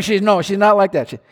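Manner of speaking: normal talking voice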